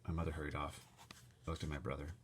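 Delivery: ordinary delivery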